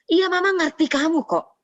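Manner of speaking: put-on high-pitched mother reassuring her child; mama voice. Mother claiming to understand while not really listening, mama voice. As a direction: play a mum's high, placating voice. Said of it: "kita sebagai orang tua sering kayak gini".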